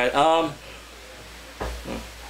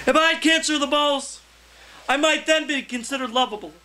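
higher, measured open-mic poem delivery; poetry reading; 8 semitones higher than usual. Acted. Poet reading his poem to an open mic crowd, declaiming. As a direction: read it to the room like a performance poem.

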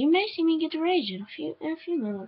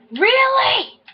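indignant disbelief; 12 semitones higher, 5 dB louder than usual. Real, not acted. Rising disbelief, repeated.

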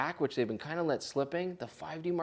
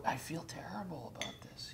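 whispering; 2 semitones higher, 14 dB quieter than usual. Real, not acted. Whispered aside while mixing drink.

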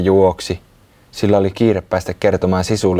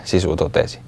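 usual speaking voice

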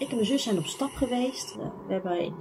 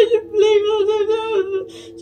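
much higher, louder sobbing plea, dragged out; retold crying; 12 semitones higher, 14 dB louder than usual. Frightened girl begs her mother from her bed, crying. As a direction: whimper and beg through tears.

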